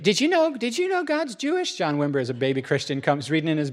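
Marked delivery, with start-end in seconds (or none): 0.0-1.8 much higher, gushing wonder; amazed discovery. Retold or imitated. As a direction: blurt it out like a new discovery. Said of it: "that idea of".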